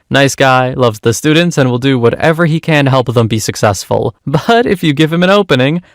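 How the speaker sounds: ordinary delivery, nothing marked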